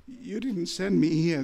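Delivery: ordinary delivery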